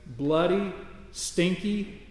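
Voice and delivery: normal talking voice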